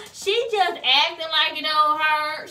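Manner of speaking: loud high slow teasing mom; mock mom. Mom telling relatives she is faking, loud mock voice.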